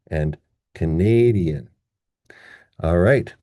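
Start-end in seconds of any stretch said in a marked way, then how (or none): 0.7-1.7 "Canadian" slow, as kuh-NAY-dee-un; stressed second syllable. Shown as a demonstration. Teacher contrasting two words, "Canadian" stressed on NAY. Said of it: "In contrast to this one".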